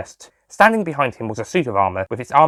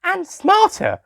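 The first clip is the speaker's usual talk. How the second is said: put-on much higher, cheeky girl's voice; high teasing girl; much slower than usual. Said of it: "added Susie".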